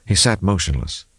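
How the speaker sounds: usual speaking voice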